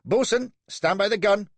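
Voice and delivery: high, loud order; captain calling to his boatswain; angry young captain. A narrator's reading. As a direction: snap the order in fury.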